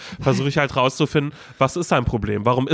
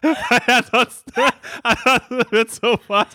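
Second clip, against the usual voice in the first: laughing; 11 semitones higher, much slower than usual. Real, not acted. Laughing about the huge biceps, higher. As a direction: laugh through the words.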